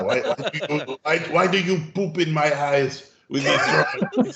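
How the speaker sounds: laughing through a mock thick Hungarian accent; Jules Engel's Hungarian accent. A Hungarian art teacher scolding a student's drawings, in a mock Hungarian accent. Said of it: "thick Hungarian accent".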